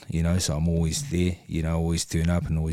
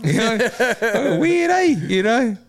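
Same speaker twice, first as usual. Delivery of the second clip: high laughing talk; 6 dB louder, much slower than usual. Real, not acted. Man laughing at his own story to friends. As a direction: laugh while you say it. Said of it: "laughing through remark".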